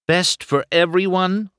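high, sharp indignant echo; Sally challenges Doc, demanding. A narrator's reading. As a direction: throw his words back at him. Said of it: "Sally demanded".

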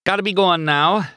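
high-pitched, nervous brush-off; hasty exit. A narrator's reading. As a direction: make a polite getaway. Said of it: "said quickly".

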